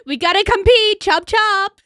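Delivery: hyped rallying cry. Pretend.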